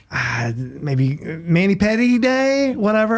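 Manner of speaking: drawn-out, rising tentative guess; clueless guessing. Acted. Husband guessing wildly in a joke.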